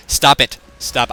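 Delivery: sharp, loud "stop it"; loud snap. Real, not acted. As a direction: bark it at the cameraman. Snapping at the cameraman.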